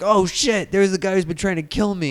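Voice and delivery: lightly acted startled kid; mock shock. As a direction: play at panicked recognition. Voices kid's shocked recognition.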